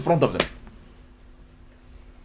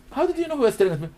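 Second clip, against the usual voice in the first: high-pitched, challenging question; incredulous questioning; 10 semitones higher, faster than usual. Acted. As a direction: press him as a baffled onlooker. Acted questioning of a fighter.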